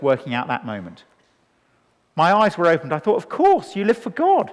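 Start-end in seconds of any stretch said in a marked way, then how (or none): 3.2-4.5 high, dawning of course; light up as it clicks; sudden realisation. Retold or imitated. His sudden realisation as a teenager.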